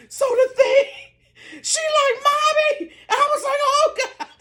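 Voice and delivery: high, laughing and half crying through the words. Real, not acted. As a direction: laugh so hard the voice cracks high. Laughing recalling pushing daughter.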